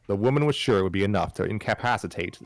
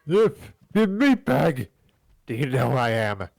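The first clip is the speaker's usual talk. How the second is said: character voice; 6 semitones higher, 5 dB louder than usual. Acted. Voiced character line in reading.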